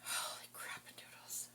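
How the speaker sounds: breathy, unvoiced exclamation under the breath; real whispered reaction to a stink; whispered disgust. Real, not acted. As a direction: whisper it, recoiling from a stench. Recoiling from a terrible stink.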